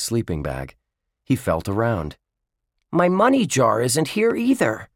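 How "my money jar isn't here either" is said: put-on high, urgent boyish voice; alarmed discovery. A narrator's reading. Dink finds his money jar missing, alarmed. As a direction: break bad news to friends, pitched high.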